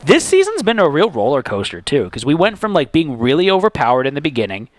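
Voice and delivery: ordinary talk, nothing marked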